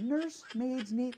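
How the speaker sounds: high, slow, hushed disbelief; incredulous echo. Retold or imitated. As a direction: repeat it as a stretched, rising question. Incredulous reply to the diagnosis.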